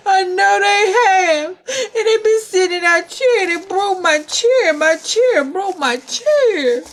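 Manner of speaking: high, loud acted bawling; crying Baby Bear. Baby Bear cries over his broken chair, high acted bawling. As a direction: wail like a small child in a tantrum.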